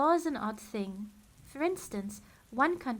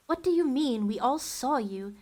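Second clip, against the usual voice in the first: higher, louder demand; sharp challenge; 3 semitones higher, 6 dB louder than usual. Acted. Olaf challenging Violet, sharp and higher. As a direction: snap back in disbelief.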